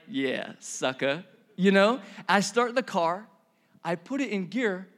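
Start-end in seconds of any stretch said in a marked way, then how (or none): 0.1-1.2 low, slow gloat to oneself; smug gloating. Acted. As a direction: draw it out low with a grin. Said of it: "grinning ear to ear".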